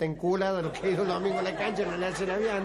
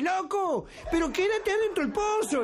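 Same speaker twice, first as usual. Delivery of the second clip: voz muy aguda de vecino que avisa; grito desde un edificio; 12 semitones higher than usual. Acted. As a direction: llamar desde lo alto, en tono agudo. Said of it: "uno que lo estaba viendo en un edificio dice". (vecino gritándole un consejo, actuado)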